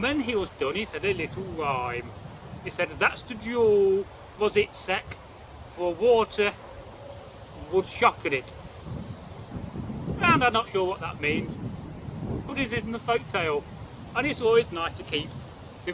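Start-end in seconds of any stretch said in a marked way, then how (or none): none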